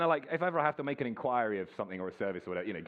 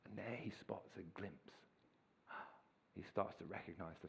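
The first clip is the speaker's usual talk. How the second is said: hushed storytelling; 2 semitones lower, 8 dB quieter than usual. Acted. Imagining the waiting father.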